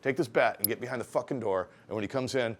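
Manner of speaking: firm commands, acted with force; urgent order. Shop boss arming apprentice against a returning gunman, urgent order.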